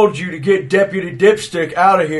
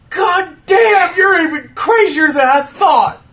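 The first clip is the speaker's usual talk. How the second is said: higher, incredulous cry; scornful outburst; 6 semitones higher than usual. Acted. Deputy scoffing at a woman's demon claim, scornful outburst.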